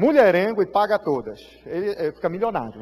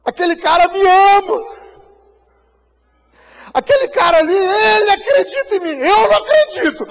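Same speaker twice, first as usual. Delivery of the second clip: sobbing shout, much higher and louder; crying out; 12 semitones higher, 11 dB louder than usual. Acted. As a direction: cry it out through tears, voice breaking. Marcelo weeping about pastor's love.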